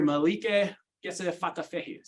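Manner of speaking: "Malike Kese Fatafehi" slowly sounded out, halting; trying the name. Shown as a demonstration. Teacher shows how she mispronounces the poet's name, slow. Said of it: "See, I can't do it".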